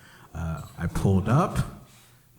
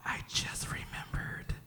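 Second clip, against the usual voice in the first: put-on gloating child whisper; 15 dB quieter than usual. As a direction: whisper it like a delicious secret. Son gloats over his prank.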